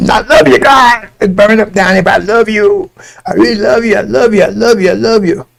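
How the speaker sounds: imitation of a pitiful worshipper; mock desperate devotion. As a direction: plead love over and over, hammed-up. Acts out professing love while burning.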